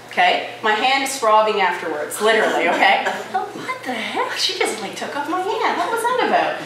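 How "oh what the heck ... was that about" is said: shocked. Retold or imitated. Shocked by crushing handshake.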